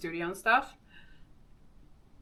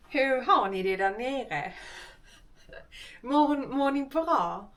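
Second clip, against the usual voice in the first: put-on Skåne accent; 5 semitones higher than usual. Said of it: "My, my Skånska is so bad".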